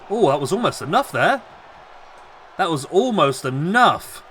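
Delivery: lively ringside wrestling call; wrestling commentator calling the match live; live play-by-play. Acted. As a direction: call every move as it lands.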